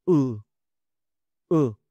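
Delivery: "U" short from the throat; throat vowel demo. Shown as a demonstration. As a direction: push "u" briefly from the throat. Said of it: "El sonido viene de la garganta". ("U" corta desde la garganta)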